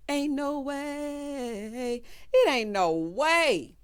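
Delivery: drawn-out, no-way refusal; slow emphatic disbelief. Real, not acted. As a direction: stretch every word in flat refusal. Slow emphatic disbelief after laugh.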